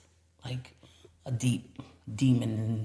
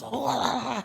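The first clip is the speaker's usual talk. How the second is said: imitated screaming; 10 semitones higher than usual. Healer fighting the demon, mock scream. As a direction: mimic someone wailing in tongues. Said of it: "he's screaming and he's fighting".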